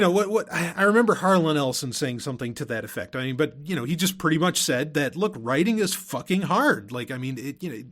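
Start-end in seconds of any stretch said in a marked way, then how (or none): none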